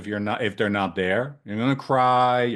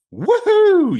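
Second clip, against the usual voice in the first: very high, loud woohoo; joyous high yell; excited whoop; 9 dB louder than usual. Pretend. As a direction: whoop like a newborn shooting out. Baby launched out at birth.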